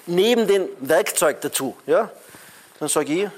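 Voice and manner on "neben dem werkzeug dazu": normal talking voice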